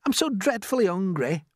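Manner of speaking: pleading Miss Spider. Acted.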